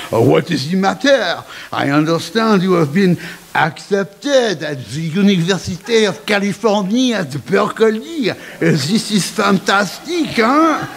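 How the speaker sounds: put-on French-accented headmaster, rising to a pleased "huh?". Headmaster congratulates student on acceptance, in a French accent. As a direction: speak English with a theatrical French accent.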